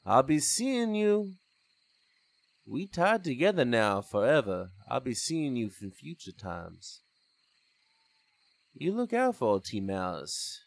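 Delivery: a hint of a mocking villain, savouring the threat; taunting villain. T Malice taunts Della as he walks off, lightly mocking.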